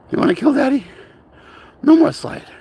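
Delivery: high, loud frantic plea; frightened owner pleading with his dog; panicked pleading. Real, not acted. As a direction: beg desperately, voice cracking high. Begging dog to stop.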